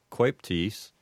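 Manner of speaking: coeptis with an oi diphthong, KOY-ptis; coeptis, Latin diphthong. Shown as a demonstration. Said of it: "two vowels alongside".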